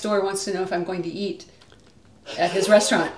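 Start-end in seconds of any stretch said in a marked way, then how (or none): 3.2-3.2 loud laugh through the words. Real, not acted. Reading a viewer's joke and laughing loudly. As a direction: burst out laughing.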